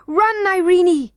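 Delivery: high, loud, drawn-out warning shout; urgent cry. A narrator's reading. Curdie warns Irene as the goblins wake, shouting. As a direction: shout for her to flee. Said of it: "cried Curdie".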